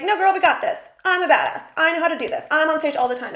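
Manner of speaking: "we got this" rally cry; hyped-up self pep talk. Retold or imitated. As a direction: psych yourself up like a cheerleader. Pep talk to herself before tour.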